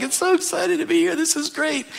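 very high, squeaky, gushing; hammed-up thrill at the heavenly banquet; giddy excitement. Preacher mimics believers at the wedding supper, squealing excitedly. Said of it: "we're like".

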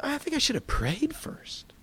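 whispering a worried self-reproach; whispered thought. Retold or imitated. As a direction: breathe it as a private regret. Anxious inner thought.

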